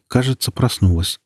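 ordinary delivery, nothing marked

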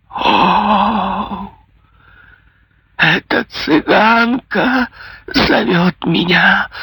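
high, slow, crazed cry; a mad recluse shrieking; wild laughing cry. A narrator's reading. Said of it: "с диким хохотом крикнула она".

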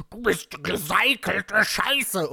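slow, hammed-up menacing insult; threatening. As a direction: draw out each word as a threat. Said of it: "drohte Shadow zurück".

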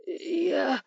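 high, tearful stammer; pitiful high-pitched falter; near tears. A narrator's reading. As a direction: start to speak on the verge of crying. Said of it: "Ростовщик уже чуть не плакал".

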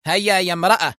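a harsh jailer pushing her forward; stern order. A narrator's reading. Prison assistant pushing Mona on, sternly ordering her. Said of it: "قائلة في صرامة".

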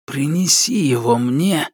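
slow, cold voice of a dark spirit; sinister shadow creature. Acted. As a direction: speak like a creeping shadow demon. The shadow demands the egg.

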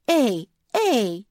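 high, loud call; crying out. A narrator's reading. Ninon calling to the creature, high shout. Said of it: "закричала Нинон".

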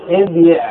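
ابيع as ib-yi', y sounded; underlying form. Shown as a demonstration. Teacher patterning the command on idrib, ib-yi'. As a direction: pronounce ابيع in two parts, ib-yi'. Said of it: "هذا الأصل".

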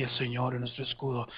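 low, soft soldiers' murmur; murmullo del ejército. Shown as a demonstration. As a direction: start the chant under your breath, low. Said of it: "empiezan a decirlo así". (murmullo bajo y grave de soldados)

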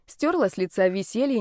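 plain reading voice

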